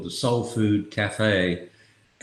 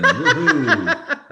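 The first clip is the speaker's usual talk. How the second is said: laughing whoop; 7 semitones higher, 5 dB louder than usual. Real, not acted. Dad cheers on podcast.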